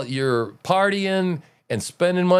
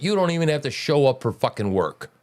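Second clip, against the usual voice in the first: ordinary talk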